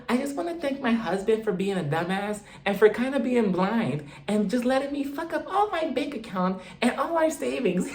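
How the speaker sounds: high, soft, airy mock toast voice with giggles; mocking Whitney. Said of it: "she's like".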